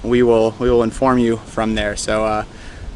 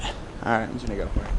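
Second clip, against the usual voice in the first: quiet; 3 semitones lower, 11 dB quieter than usual. Real, not acted. Sneaking past a fence.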